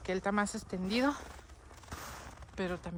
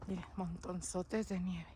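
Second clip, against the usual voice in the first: soft and slow; 2 semitones lower, 13 dB quieter, slower than usual. Real, not acted. Marvelling at the snow piles.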